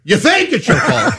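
high, exasperated mock outburst; incredulous retort. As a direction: throw it back at the culprit, half laughing. Retold incredulous comeback to coworker.

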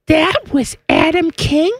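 put-on high, slow, amazed teen girl; surprised girlish voice. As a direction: gasp it out like a teenage daughter. Daughter quoted, surprised.

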